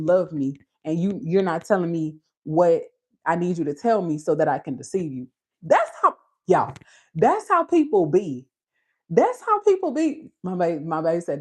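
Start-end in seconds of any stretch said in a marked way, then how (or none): 5.6-10.3 leaps way up, preaching; high exclaim. Real, not acted. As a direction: cry it out high to the crowd.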